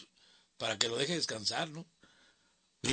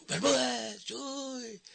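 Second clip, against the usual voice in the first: much higher mock yell, fading; drunk heckler's call; 13 semitones higher, much slower than usual. Drunk fan heckling the announcer, high mock yell. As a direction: shout his name across the crowd. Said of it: "El hombre me gritaba".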